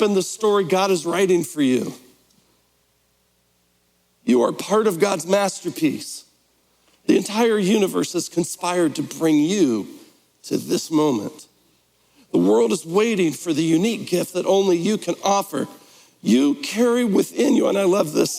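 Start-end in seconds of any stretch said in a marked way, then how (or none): none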